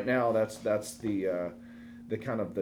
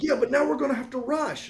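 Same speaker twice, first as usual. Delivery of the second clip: put-on much higher, louder kid voice; kids complaining; 5 dB louder than usual. Children upset they now have to rush, high squeal. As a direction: squeal it like an annoyed six-year-old. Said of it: "They're like".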